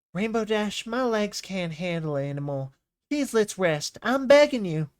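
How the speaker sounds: high, pleading little pony begging to rest; pleading little filly. Acted. Voices Apple Bloom character pleading.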